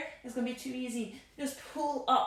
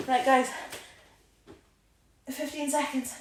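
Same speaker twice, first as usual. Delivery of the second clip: usual speaking voice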